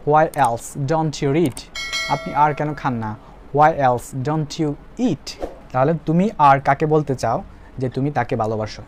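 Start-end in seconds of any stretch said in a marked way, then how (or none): 0.1-1.6 very slow, every word separated for the class; slow model sentence. Shown as a demonstration. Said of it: "teacher models the English sentence". 3.5-5.5 very slow, every word separated for the class; slow model sentence. Shown as a demonstration. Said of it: "teacher models the English sentence".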